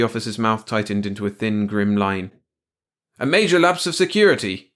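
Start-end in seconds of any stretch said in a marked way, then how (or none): 3.2-4.7 high, loud snap; snapping. A narrator's reading. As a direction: pronounce it sternly and loudly. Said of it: "he snapped".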